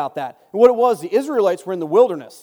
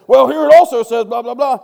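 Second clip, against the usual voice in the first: mayor retelling; 3 semitones higher, 10 dB louder than usual. Retold or imitated. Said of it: "And the mayor says".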